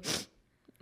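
runny nose, sniffling. Real, not acted. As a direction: sniff through a blocked nose. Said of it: "Oh, you sniffled?".

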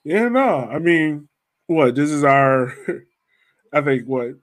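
normal talking voice

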